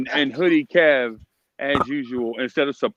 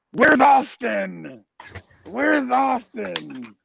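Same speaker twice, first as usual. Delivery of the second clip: slow, higher Batman-style voice; Hoodie Kev Batman voice; 10 semitones higher, much slower than usual. Retold or imitated. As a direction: drag it out like the Dark Knight. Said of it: "here's my best Hoodie Kev".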